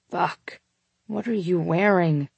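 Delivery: understated groan of desire. A narrator's reading. Man to lover he has pinned to the wall, groaning. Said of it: "he groaned into my neck".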